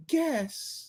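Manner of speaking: high, soft, teasing one-word answer; coy reply. Acted.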